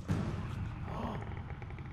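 sharp frightened intake of breath; breathless scared gasp; terrified gasp. Real, not acted. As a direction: gasp in shock at the monster. Sees big monster.

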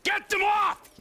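sudden shout. Acted. Sudden shouted order after whisper.

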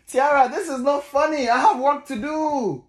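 loud, fed-up telling-off; exasperated scolding. Real, not acted. Dad scolding giggling baby who won't sleep, loud and exasperated.

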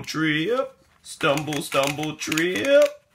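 usual speaking voice

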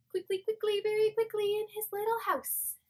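bright quick mouse line building up; playful rush into the tickle; lively mouse verse. Shown as a demonstration. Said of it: "it goes like this".

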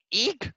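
very high frightened eek; scared shriek. A narrator's reading. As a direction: squeal in sudden fright. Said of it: "shrieked the scared Mister Hedgehog".

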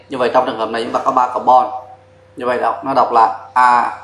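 ordinary delivery

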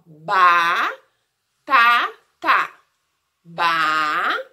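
"batata" broken into long syllables with clear gaps. Shown as a demonstration. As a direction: stretch the first "ba" in "batata", then pause before each syllable. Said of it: "Olha, presta atenção".

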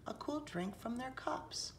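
a delicate hushed aside; whisper. A narrator's reading. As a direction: breathe the image gently. Gentle narration image.

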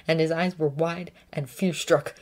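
usual speaking voice

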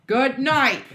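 loud, high holler; yelling. Real, not acted. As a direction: yell goodnight across the house. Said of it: "I apologize for my co-host yelling".

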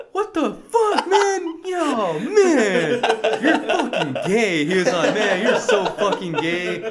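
high, loud disgusted yelling, laughing through it; mock the furious brother; brother's outburst. Brother yelling at him from the shower, disgusted high shout. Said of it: "and my brother was like".

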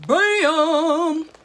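high, loud, drawn-out BAM; sudden ringing shout; loud exclamation. Real, not acted. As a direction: belt it out as a big reveal. Punctuates the wig reveal.

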